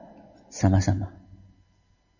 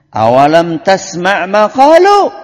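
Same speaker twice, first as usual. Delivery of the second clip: loud; 10 dB louder, much slower than usual. Acted. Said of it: "Aisyah jawab lagi".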